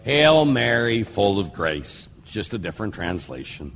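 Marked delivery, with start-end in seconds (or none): 0.0-2.1 slow, measured Hail Mary; slow devotional recitation, falling at the end; prayer recitation. Shown as a demonstration. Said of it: "We say that by saying".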